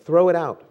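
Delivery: P=A; firm command. Acted. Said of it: "repeated exhortation".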